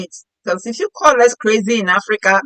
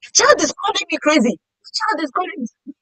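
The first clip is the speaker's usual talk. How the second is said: shocked mother; 8 semitones higher, 3 dB quieter than usual. Retold or imitated. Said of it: "My mother's like".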